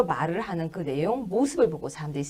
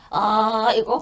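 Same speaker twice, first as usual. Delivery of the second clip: brief imitation of a throaty, phlegmy voice; louder mock throat-rattle; 4 dB louder, much slower than usual. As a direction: demonstrate a phlegm-clogged groan. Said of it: "약간 가래 끓는 목소리?".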